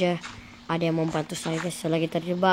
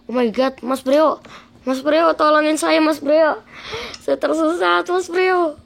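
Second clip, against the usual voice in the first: pleading; 9 semitones higher, 7 dB louder, slower than usual. Pretend. Lost, begging friend for help.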